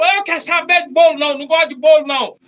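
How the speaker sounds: high, loud petulant outburst; mock angry outburst. Refusing the pastor's cake, a high loud sulky outburst. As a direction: sulk and shout at him. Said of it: "Eu tô com raiva, eu tô nervoso".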